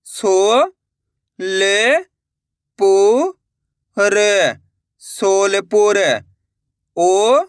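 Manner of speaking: slow syllable dictation with drawn-out blends; slow spelling. Shown as a demonstration. Teacher drilling students on the o vowel sign, slow and deliberate. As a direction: sound out each piece, then stretch the word.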